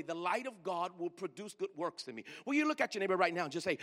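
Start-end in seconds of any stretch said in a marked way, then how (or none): 0.0-2.2 said slowly. Shown as a demonstration. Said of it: "You need to say that real slowly".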